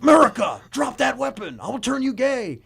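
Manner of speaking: loud, high mock battle cry at the enemy; mock shout. Mimicking the congressman yelling at a terrorist, loud and high. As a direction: shout the threat, hammed-up and high. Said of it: "he's like".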